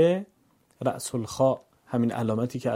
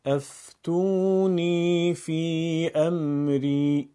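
افتونی فی امری chanted slowly in one unbroken line; connected recitation; 5 semitones higher, 3 dB louder, much slower than usual. Shown as a demonstration. Teacher follows with continuous reading: افتونی فی امری in one flowing chant. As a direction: link افتونی فی امری with no pauses, long sustained vowels. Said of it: "پیوسته".

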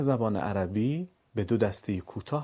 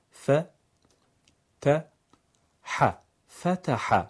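ف ت ح split slowly, then فتح whole; syllable by syllable; 4 semitones higher, 4 dB louder, much slower than usual. Shown as a demonstration. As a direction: spell فتح out piece by piece. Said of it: "هجا هجا یا بخش بخش".

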